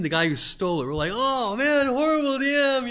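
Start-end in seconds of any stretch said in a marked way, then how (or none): 1.1-2.9 high, loud mock booing; group jeering. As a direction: jeer at the DM like the whole table. Group jeers at the GM.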